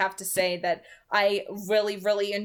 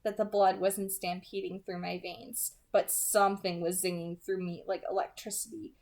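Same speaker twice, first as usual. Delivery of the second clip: hushed, intimate audiobook reading; soft story narration; 6 dB quieter than usual. A narrator's reading. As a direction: read it softly, drawing the listener in. Reading novel narration aloud.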